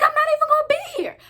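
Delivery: fast, high indignant coworker voice. Retold or imitated. Coworker vowing not to show up, fast high indignant voice. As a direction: rattle it off high and fed up. Raven declaring she will leave.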